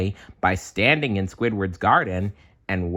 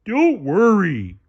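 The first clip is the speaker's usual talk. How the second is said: loud, high, dopey starfish voice; Patrick voice; 12 semitones higher, 6 dB louder, slower than usual. A narrator's reading. Patrick guessing at SpongeBob's news, loud dopey voice. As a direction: ask eagerly and slow. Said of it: "Patrick said".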